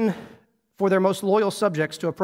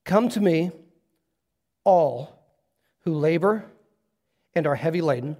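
scripture reading; 2 semitones lower, slower than usual. Acted. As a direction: let each phrase land gently, with pauses. Let me read it again.

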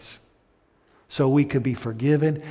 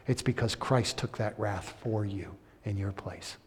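softer, whispered reassurance; 4 semitones lower, 7 dB quieter than usual. Acted. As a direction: lean in and whisper the promise. Quiet close of the sermon.

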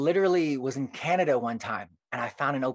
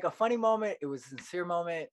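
ordinary delivery

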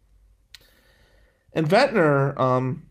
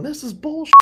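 higher, louder imitated angry mom; mom pissed off; 5 dB louder than usual. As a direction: play the furious mother under the phone. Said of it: "And Mom's pissed off".

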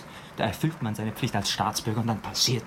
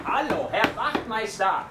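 slow, raised call towards the closed door; 8 semitones higher, slower than usual. Acted. Young man calls for the sergeant at the station, raised polite call.

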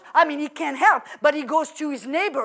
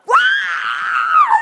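a sudden high yelp of joy, much higher and louder; excited squeal; 6 dB louder than usual. Acted. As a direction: squeal like an overjoyed child. Kids jumping in excitement.

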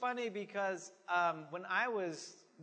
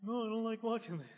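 lightly voiced wife, a bit quick; a hint of the wife's voice; Wendy impression; 4 semitones higher, faster than usual. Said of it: "you like my impersonation of you, honey".

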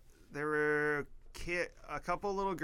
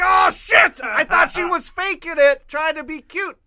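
loud, high-pitched mock-panicked shout; yelled comic dismay, voice shooting up; horrified outburst; 13 dB louder than usual. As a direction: shriek it out as a retold embarrassed realization. Retells his horrified realization.

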